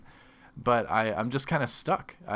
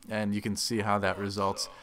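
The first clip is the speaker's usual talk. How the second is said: ordinary delivery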